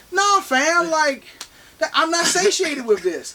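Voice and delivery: high-pitched, exasperated refusal; indignant protest. Real, not acted. Protesting the film's attempt to placate.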